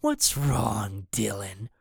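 low, softening menace; quietly taunting threat; taunting villain. A narrator's reading. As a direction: drop low and soft on the name. Villain taunts boy over radio.

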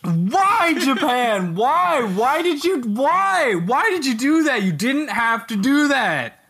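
high, pleading repeated why; outraged disbelief. Real, not acted. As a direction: beg the country for an explanation.

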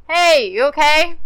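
loud, slow call across distance. Pretend. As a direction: yell to someone far off. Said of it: "he could totally hear us if we yelled".

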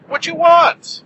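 shouted high, loud and emphatic; loud high echo. Acted. Shouted echo of previous line.

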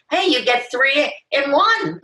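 high, upbeat mock bravado; playful sales pitch. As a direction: sell the botched audition brightly. Acted pitch to casting people.

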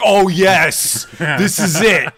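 high, loud, delighted gasp of discovery; mock excitement. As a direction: act thrilled like a kid finding a ride. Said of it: "you're like".

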